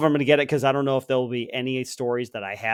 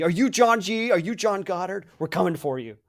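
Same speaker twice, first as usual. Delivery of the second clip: higher-pitched mock interrogation; mock threat; 5 semitones higher than usual. Podcaster playing angry listeners hunting down superfan, mock threat. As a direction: play an accusing mob, lightly hammed-up. Acted online mob hunting the fan.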